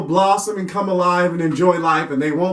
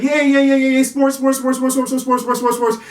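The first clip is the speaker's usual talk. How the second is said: mock cheering; 6 semitones higher than usual. Parent pushing the kid into sports, mock chant.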